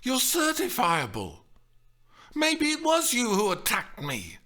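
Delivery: high-pitched indignant charge; high, heated and suspicious; angry accusation. A narrator's reading. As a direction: accuse him in outrage. Woman accusing man in quarrel.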